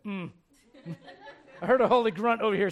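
ordinary talk, nothing marked

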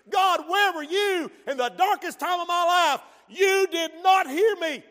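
loud, high cry at God; bitter accusation. Retold or imitated. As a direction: throw the hurt at God.

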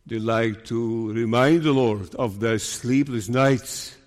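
ordinary talk, nothing marked

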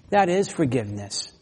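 normal talking voice

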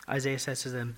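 normal talking voice

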